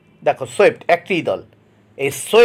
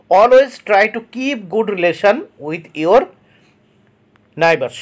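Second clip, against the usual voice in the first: slow dictation reading; much slower than usual. Shown as a demonstration. Teacher reads example sentence aloud.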